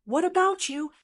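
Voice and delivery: sharp, high angry retort. Acted. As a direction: snap it back in anger. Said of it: "said angrily".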